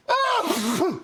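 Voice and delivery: sudden high-pitched cry of pain; sharp high yelp. Retold or imitated. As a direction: yelp out in shock through the mask. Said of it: "I hear him go".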